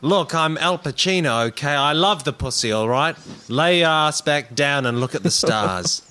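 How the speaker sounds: loud, high, insistent declaration; defensive outburst. Acted. Rapper insisting to comrades he loves women, loudly defensive. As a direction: push it out forcefully, pitched high. Acted outburst proving his manliness.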